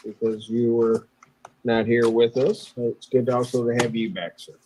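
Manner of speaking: normal talking voice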